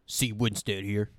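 drawn-out deep-voice imitation. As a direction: announce yourself slowly in a put-on deep voice. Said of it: "the deep voice who goes".

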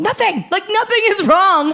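high insistent denial. Retold or imitated. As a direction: deny it in a high voice. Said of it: "I'm literally going".

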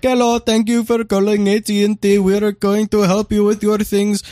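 high, mock Indian accent; hammed-up Indian customer service voice; imitating Indian call agent. Caller mimics the polite agent, in a mock Indian accent. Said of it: "The first guy I talked to was like".

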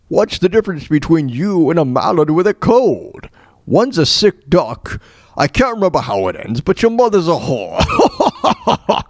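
slow, drawn-out Scottish Connery punchline; imitating Sean Connery. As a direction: savour the insult like Connery. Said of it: "where he says".

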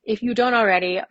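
normal talking voice

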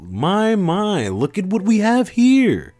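very high, drawn-out mock-sweet drawl; woman's voice. A narrator's reading. Woman arriving behind the narrator, high theatrical taunt. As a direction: sing it out high, relishing the discovery. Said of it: "a woman said from behind me".

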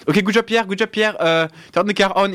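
high-pitched French race engineer; French accent. Acted. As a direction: imitate a French engineer on team radio. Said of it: "I can do a French accent".

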